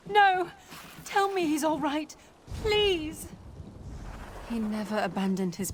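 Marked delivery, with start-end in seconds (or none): 0.1-0.5 anguished cry. Acted. Daughter hears her father died. 1.0-3.3 desperate plea. Acted. Begging to hear father lives.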